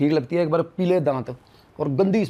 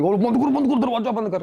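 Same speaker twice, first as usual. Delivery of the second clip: a frightened man yelling to slam the door shut; retold panicked scream; 9 semitones higher than usual. Scared driver yelling at friend to shut door, panicked yell. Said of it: "इसने चीखें मारी".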